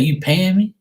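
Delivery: loud, high, rising challenge; cheeky comeback. Retold or imitated. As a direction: throw it back as an incredulous question. Cheeky comeback to dad, retold.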